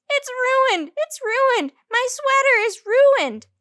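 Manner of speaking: shrill-free high loud wail of dismay; yelling. A narrator's reading. May wailing about her ruined sweater, loud and high. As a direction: scream it out in horror.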